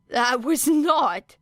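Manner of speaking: a touch out of breath, pushing it out; wheezing. A narrator's reading. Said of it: "he wheezed".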